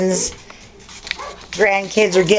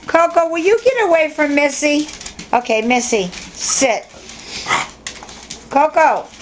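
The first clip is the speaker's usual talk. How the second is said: ordinary delivery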